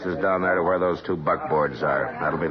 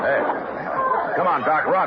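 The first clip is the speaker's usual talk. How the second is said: raised, higher urgent call to hurry; 11 semitones higher than usual. Acted. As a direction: call out to get him running. Urging Doc to run toward shot.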